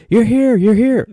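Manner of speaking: high, loud eager cry of a strange man; excited stranger. Retold or imitated. Stranger greets the landing crew, high and eager. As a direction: squeal it like a wild stranger overjoyed to see you.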